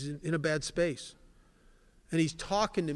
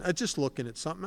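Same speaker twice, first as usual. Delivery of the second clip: rushed excuse; much faster than usual. Retold or imitated. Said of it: "caught out, stammering excuse".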